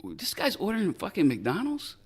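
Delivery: high-pitched baffled question; mock disbelief. Agent's unspoken reaction to the food order, incredulous. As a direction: imitate someone who can't believe this. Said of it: "that's the look he had".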